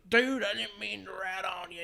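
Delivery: lightly voiced drunk skater; drunk Bam voice. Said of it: "trying to do a Bam with Elazar".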